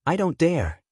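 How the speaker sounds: rough, hoarse throat. A narrator's reading. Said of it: "said in a hoarse voice".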